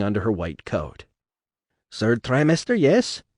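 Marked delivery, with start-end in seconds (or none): 1.9-3.2 put-on German accent. A narrator's reading. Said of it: "Her accent, mixing German and Eastern European".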